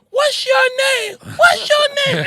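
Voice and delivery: very high, slow, shrill-pitched mock shriek of his name question; screaming girlfriend. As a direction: ham up a panicked woman yelling at him.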